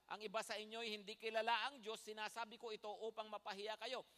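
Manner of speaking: ordinary talk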